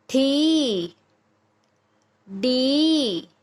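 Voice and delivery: each syllable, खी to मी, with a long held ee; long vowel drill. Shown as a demonstration. Teacher drilling consonants with the big ee sign, long vowels. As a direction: chant खी, गी, घी singly, stretching every ee. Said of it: "इसका उच्चारण थोड़ा लंबा और ऊंचा बोला जाएगा".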